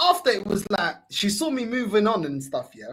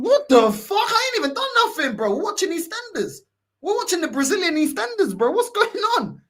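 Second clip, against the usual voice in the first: much higher, incredulous; indignant protest; 9 semitones higher than usual. Retold or imitated. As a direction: protest as if you've done nothing wrong. Retells his protest at being choked.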